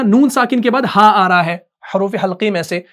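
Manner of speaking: normal talking voice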